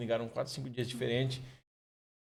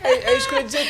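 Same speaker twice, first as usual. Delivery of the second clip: laughing; 9 semitones higher, 9 dB louder, much faster than usual. Real, not acted. Laughing agreement in chat.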